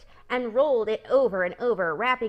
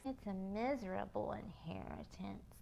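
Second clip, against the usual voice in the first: much softer, glum; sad quiet remark; 14 dB quieter than usual. Acted. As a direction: mourn her fate quietly. Pig sadly comments.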